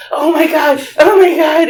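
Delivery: loud, high frantic cries; acted panic. Aunt swerving with someone on the roof, panicking. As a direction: escalate in hammed-up terror.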